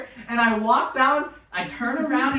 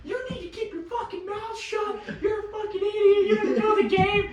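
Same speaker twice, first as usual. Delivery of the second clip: high, put-on angry kid voice; mimicking the kid's trash talk; mocking the kid; 12 semitones higher than usual. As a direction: imitate the kid mouthing off. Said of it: "and goes".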